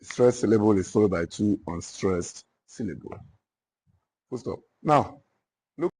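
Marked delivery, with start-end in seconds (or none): 0.0-4.6 deliberate dictation, long pauses between phrases. Real, not acted. Teacher dictating the dactylic foot to note-takers, dictation. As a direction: dictate it in small repeated chunks for note-takers.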